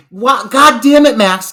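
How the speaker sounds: loud fed-up scolding; exasperated groan. Real, not acted. Reviewer fed up with the douchey character, loud exasperation. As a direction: throw up your hands at him. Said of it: "exasperated at Max".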